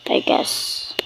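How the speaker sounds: ordinary delivery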